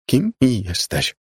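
choked out. A narrator's reading. Margo finally addresses the floating girl, choked and slow. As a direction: force the words out with difficulty. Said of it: "wykrztusiła w końcu".

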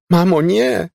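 high, loud desperate plea; anguished cry. A narrator's reading. Elizabeth calls to her mother too late, anguished cry. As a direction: call out in terrible anguish. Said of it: "wołała w potwornej udręce".

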